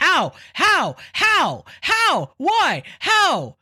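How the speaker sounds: escalating mock disbelief cry; mock scream. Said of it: "repeatedly scream at your device".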